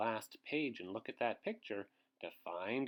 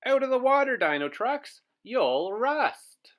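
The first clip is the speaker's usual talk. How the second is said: high, loud calling out; urgent shout from the shore; shouted warning; 12 semitones higher, 12 dB louder than usual. Acted. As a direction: yell a warning across the water. Warning call in story.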